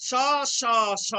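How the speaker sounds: all three স said as the same "sh"; each স separate, a clear palatal sh; identical sh sounds. Shown as a demonstration. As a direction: say every স like English SH. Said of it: "তালব্য স এর মতোই উচ্চারিত হয় তিনটি স".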